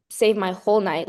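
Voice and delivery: ordinary talk, nothing marked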